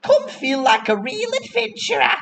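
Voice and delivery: very high-pitched market seller's cry; street hawker. Acted. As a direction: hawk novelty wares in a high put-on voice. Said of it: "you'll hear somebody saying".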